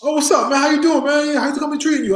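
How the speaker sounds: friendly greeting. Retold or imitated. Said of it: "He goes".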